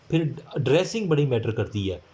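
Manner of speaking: ordinary talk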